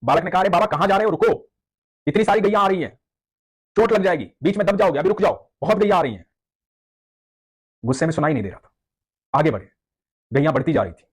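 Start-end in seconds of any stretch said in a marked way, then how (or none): 0.4-6.2 put-on higher child's voice calling out; calling boy. Cowherd boy calling to the angry devotee, high and urgent. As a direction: call after the old man like a village boy. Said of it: "एक बालक ने आवाज दी".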